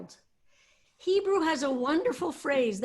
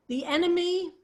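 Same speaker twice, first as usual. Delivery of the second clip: enemy said higher and louder; emphasis on enemy; 7 semitones higher, 4 dB louder than usual. Shown as a demonstration. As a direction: punch the enemy as the opening word. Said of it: "there's a kind of an emphasis on the word".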